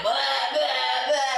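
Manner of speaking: repeated laughing mock gag; imitated retching. Mother retelling vomiting while holding baby, mock retching. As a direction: gag out each bleh. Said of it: "I'm like".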